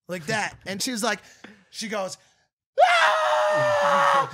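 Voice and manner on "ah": high shrill shriek, hammed up; imitated scream. Girlfriend startled by the leaf, imitated high scream. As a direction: shriek like she just saw a rat. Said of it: "and starts screaming".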